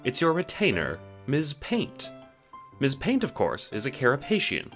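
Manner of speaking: normal talking voice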